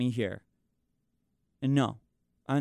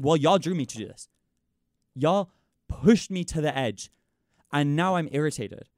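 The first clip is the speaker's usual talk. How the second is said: louder, pushed, every word pressed out; retold angry outburst; 3 semitones higher, 6 dB louder than usual. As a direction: throw it back at them like you've had enough. Retelling her own angry outburst.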